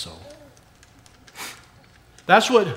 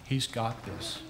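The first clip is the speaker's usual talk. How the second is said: soft, low reassurance; a quiet gentle promise; gently reassuring; 4 semitones lower, 9 dB quieter, slower than usual. Acted. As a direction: say it softly, like a comfort. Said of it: "he gently reminds me".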